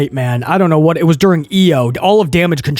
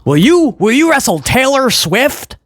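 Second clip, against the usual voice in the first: lightly mocking, higher-pitched question; mock reporter; 6 semitones higher than usual. Reporter asking E O to wrestle Taylor Swift, mocked. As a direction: parody the silly question, voice pitched up.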